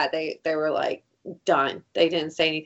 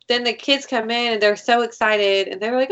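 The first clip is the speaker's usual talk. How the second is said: ordinary talk, nothing marked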